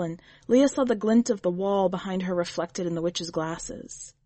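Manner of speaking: usual speaking voice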